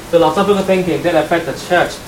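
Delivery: normal talking voice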